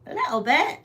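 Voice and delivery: "A little bit" in a mock British lilt, rising at the end; light put-on British accent. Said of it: "say that in a British accent".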